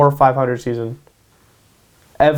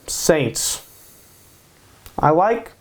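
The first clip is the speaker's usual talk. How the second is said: normal talking voice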